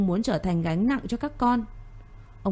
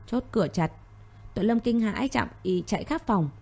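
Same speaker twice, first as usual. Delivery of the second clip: ordinary delivery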